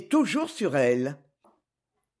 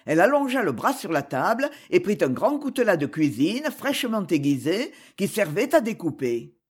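ordinary delivery, nothing marked

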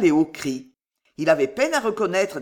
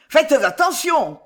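loud, high, drawn-out warning shout; 9 semitones higher, 7 dB louder, slower than usual. A narrator's reading. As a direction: call out sharply to the workmen, louder and higher. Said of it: "cria-t-il".

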